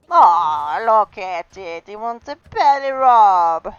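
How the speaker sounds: loud gushing pet talk, rising at the end; cooing. Real, not acted. Player seeing pet want belly rub, cooing. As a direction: gush over an adorable pet, stretching words.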